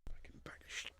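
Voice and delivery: unvoiced under-the-breath grumble; whispered fed-up swearing; whispered curse. Real, not acted. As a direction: hiss it to yourself in disgust. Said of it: "muttered under breath".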